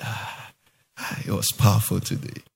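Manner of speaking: low, self-pleased sigh after preaching; mock satisfied sigh. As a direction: sink into the chair, pleased with yourself.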